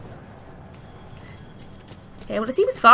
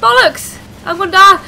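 loud, high panicked shouts; panicked yelling; 11 semitones higher, 12 dB louder than usual. Real, not acted. Trapped under fire, panicked screaming.

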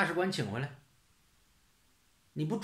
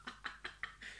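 soft snicker; 18 dB quieter than usual. Real, not acted. Laughs at his own logic.

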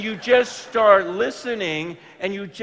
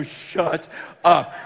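loud, incredulous outburst; thrilled disbelief, heavy punch on each phrase; acted-out astonishment; 8 dB louder, slower than usual. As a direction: belt it out, stretching words in amazement. Acting amazement at answered prayer.

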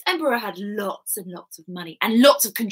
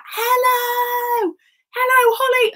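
high drawn-out call; big shouted hello; 13 semitones higher, 6 dB louder, slower than usual. Shown as a demonstration. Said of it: "Can you shout me a big hello?".

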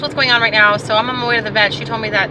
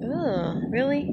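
usual speaking voice

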